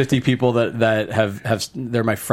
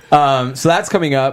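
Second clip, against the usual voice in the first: usual speaking voice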